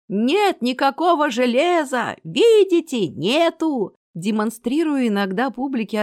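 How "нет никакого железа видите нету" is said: loud, high, drawn-out showman's patter; fairground barker. A narrator's reading. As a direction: proclaim it to the whole crowd. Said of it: "заводил другую важную речь".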